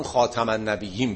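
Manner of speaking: "خاتم" as khaa-tam, with a in the second syllable; khaatam reading. Shown as a demonstration. Teacher recommends the Hafs reading, "خاتم" as khaatam. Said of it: "بهتره که اونو مراعات بکنه".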